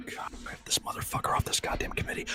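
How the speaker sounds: hushed, grumbling whisper; mock whispered aside. Booker whispering a complaint about a colleague. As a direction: whisper it so he can't hear.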